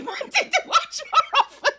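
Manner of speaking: high giggling read through a laugh. Real, not acted. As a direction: laugh while reading it out. Laughing while reading donation.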